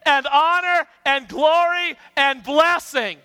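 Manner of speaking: high, slow congregational shout; big drawn-out chant of praise; loud voice. Shown as a demonstration. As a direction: proclaim each word at full volume. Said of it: "We're gonna say this with a loud voice".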